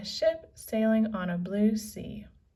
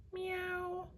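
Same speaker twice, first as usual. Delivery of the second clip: high, soft little meow; playful kitten mew; cat meow; 10 semitones higher, 9 dB quieter than usual. Acted. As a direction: purr it like a cat. Annie playfully meows.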